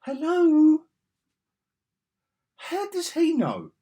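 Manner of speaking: high, loud disbelief, the last bit slow; incredulous. Real, not acted. Reviewer scoffs at the script, high and loud. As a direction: stretch out the outrage. Ranting at bad dialogue.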